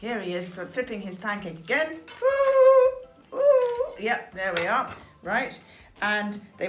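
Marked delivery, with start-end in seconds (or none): 2.2-3.9 very high, loud ooh; excited ooh. Acted. The wolf flipping his pancake, a high loud ooh. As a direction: squeal an ooh of delight.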